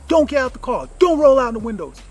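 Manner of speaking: loud, high commanding yell; aggressive. Retold or imitated. Husband ordering his wife to stay in the car, angry shout. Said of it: "real, like, aggressive".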